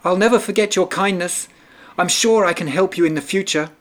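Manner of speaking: high mouse voice, fast and eager; grateful little mouse. A narrator's reading. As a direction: gush thanks like a tiny mouse. Mouse thanks the lion.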